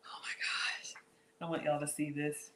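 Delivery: awed whisper; soft unvoiced wonder. Real, not acted. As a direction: hush it like a secret. Whispering over the cake.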